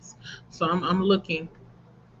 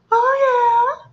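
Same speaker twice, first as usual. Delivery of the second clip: a squeal on "Oh", much higher and louder; squealed oh yeah; 5 dB louder than usual. Shown as a demonstration. Streamer cheering harder for the reading arc, squealed. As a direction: go even bigger, a high squeal on "Oh".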